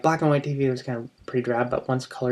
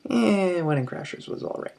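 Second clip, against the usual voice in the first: meh, shrugging verdict; unimpressed; 6 semitones higher, 3 dB quieter than usual. Retold or imitated. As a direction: sound underwhelmed by the film. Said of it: "you'd leave and be like".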